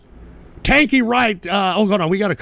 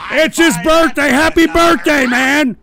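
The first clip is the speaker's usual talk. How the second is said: loud, high laughing birthday cheer; cheerful birthday shout; 5 semitones higher, 5 dB louder than usual. Real, not acted. Wishing a chatter happy birthday, loud and cheerful. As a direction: whoop it out happily.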